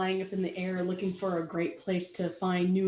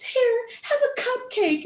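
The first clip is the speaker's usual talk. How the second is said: much higher, cheerful offer; much higher, sweet; sweet baker pony; 13 semitones higher, 3 dB louder than usual. Acted. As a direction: coax him with a treat. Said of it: "she says".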